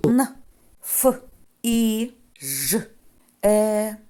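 н, ф, і, ж, е as separate isolated sounds; isolated speech sounds; spelled sounds. Shown as a demonstration. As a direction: sound out each letter with pauses. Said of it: "А якщо я скажу".

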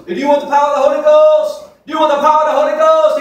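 shouted high, hammed-up revival preacher; mock revival preacher. Imitating an African revival preacher calling out to thousands. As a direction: bellow it like a preacher whipping up a crowd. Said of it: "his message was".

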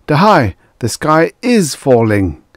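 high, loud, breathless alarm; panicked outburst. A narrator's reading. Prime Minister bursts in on the Emperor, panicked high outcry. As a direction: blurt it out as if the sky really falls. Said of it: "Tors burst out unheedingly".

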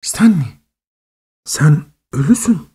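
high, catching voice near tears; choked with emotion. A narrator's reading. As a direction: force the words out through a tight throat. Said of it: "Kelimeler boğazımda düğümlendi". (boğazı düğümlenmiş, ağlamaklı bir sesle)

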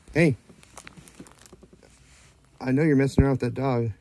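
ordinary talk, nothing marked

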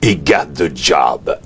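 slow, put-on big voice; imitated big voice. Big voice in the background announcing the casting result, drawn out. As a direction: play the big voice calling from behind. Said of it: "la grosse voix qui dit".

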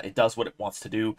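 normal talking voice

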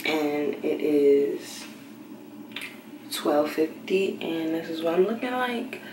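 normal talking voice

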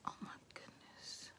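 hushed, breathy whisper of awe. Real, not acted. Vlogger admiring earrings for viewers, whispering. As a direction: whisper it like it's too precious to say aloud. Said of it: "Why am I whispering?".

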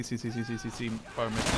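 ordinary talk, nothing marked

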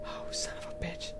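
hushed, breathy curse; tense whisper. Real, not acted. Scared gamer hiding from a killer, whispered curse. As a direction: whisper it so nothing hears.

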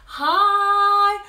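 sung high and loud; high sung note. Shown as a demonstration. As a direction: belt a high note for the kids. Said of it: "High, are your hands in the air".

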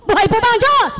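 loud, high call to the flock; fiery preacher call-and-response; preaching at full force. Acted. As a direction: rouse the congregation with ringing questions. Preacher rallies.

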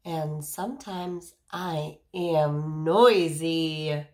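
slow, loud, boisterous; playful noisy read. Acted. Teacher reads the noisy page loudly to children.

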